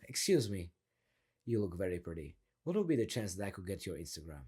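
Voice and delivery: low, soft, controlled pick-up line; deep voice. Shown as a demonstration. Coach models asking a girl for her Instagram, deep voice. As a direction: drop the voice low and ease down at the end. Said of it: "little bit of a deep voice".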